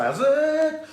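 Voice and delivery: high, loud northern suburbs greeting; northern suburbs Cape Town accent. Acted. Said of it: "the northern suburbs, you say".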